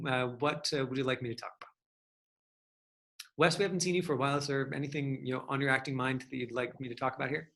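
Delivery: ordinary talk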